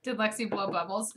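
usual speaking voice

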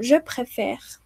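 "Je préfère" ending on the r; silent final E. Shown as a demonstration. Teacher matches French to the Spanish present, final E silent. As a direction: leave the last e of "Je préfère" unspoken. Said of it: "esa última E no suena".

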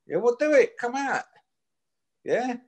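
high, heated taunt; taunting challenge. Real, not acted. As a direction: goad him to come out, pitched high. Taunting rival to fight.

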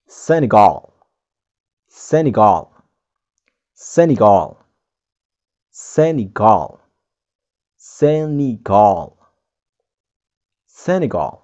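Senegal said SEN-ih-gawl, stress up front; English Senegal. Shown as a demonstration. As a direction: Senegal with a clear ee and a long gawl end. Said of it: "bisa kita ucapkan dengan".

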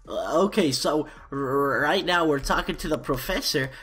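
usual speaking voice

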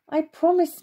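put-on boy protesting his honesty; insisting. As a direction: plead to be believed. Andrew insists to his mother.

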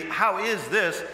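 slow "How is this", rising; stress on How. Shown as a demonstration. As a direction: draw "How" out and lift the end.

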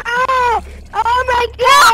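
loud frightened yell; panicked shout. Real, not acted. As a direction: scream in game panic. Panicked scream in game.

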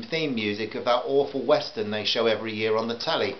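ordinary delivery, nothing marked

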